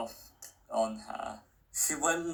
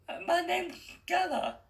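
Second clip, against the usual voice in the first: put-on much higher, unsure girl's voice; young girl Stella. A narrator's reading. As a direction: sound like the hesitant heroine.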